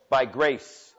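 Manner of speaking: impassioned preacher, rising and pressing; preaching at full force. Acted. Pastor urging the congregation, fervent preaching. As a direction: drive the sermon home to the church.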